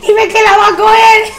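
high, loud mock-crying plea; tearful begging. Crying fan begging the guard at a concert, tearful. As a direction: wail it desperately, hammed-up. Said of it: "Ya no llore".

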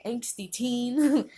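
hammed up like a listener parroting it back; drawn-out mock catchphrase. Listener parroting the catchphrase, slow and hammed up. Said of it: "You're gonna be saying".

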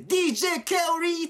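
high hyped DJ call, stretched out; DJ shout-out. Retold or imitated. Co-host imitating the DJ drop from 2000s J-pop remixes, hyped call. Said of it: "その曲の途中途中で".

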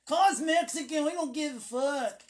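imitated loud, high-pitched outburst; brash quoted retort. Coworkers answering what to call them, brash loud imitation. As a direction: throw it out loud and high as someone else's answer.

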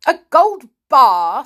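loud, high, drawn-out scoff; scornful. A narrator's reading. Father scoffing at the child's idea, scornful. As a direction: echo it with derisive disbelief. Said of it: "said her father with a snort".

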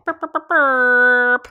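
playful burping noise sign-off; silly burp noises. Pretend. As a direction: goof off with a drawn-out burp sound. Silly sound for guest intro.

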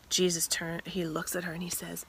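ordinary talk

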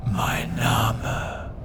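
ghostly whispered name; eerie whisper. Acted. Sand demon reveals its name to Gareth, whispering eerily. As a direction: breathe the name like a spirit.

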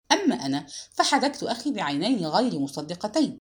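usual speaking voice

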